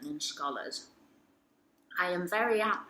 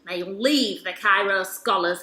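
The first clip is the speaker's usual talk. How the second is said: louder, pressing each word with indignation; loud indignant demand; 4 semitones higher, 10 dB louder than usual. Acted. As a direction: declare it loudly, demanding his removal. Demanding a member be expelled.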